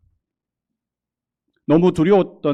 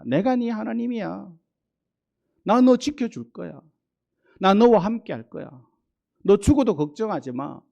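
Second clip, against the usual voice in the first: higher, gentle fatherly voice of God; God's tender reassurance; 6 semitones higher than usual. Acted. God comforting a fearful believer, gentle and reassuring. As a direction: speak as God soothing a frightened child.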